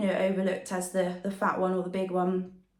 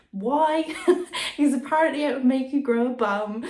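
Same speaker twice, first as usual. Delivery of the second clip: laughing; 5 semitones higher, 6 dB louder than usual. Real, not acted. Amused aside about gym myth.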